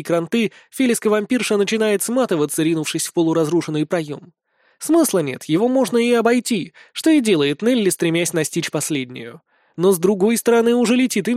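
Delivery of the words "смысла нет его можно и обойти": usual speaking voice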